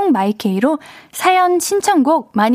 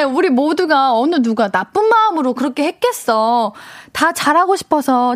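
normal talking voice

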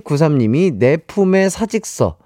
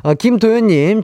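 ordinary delivery

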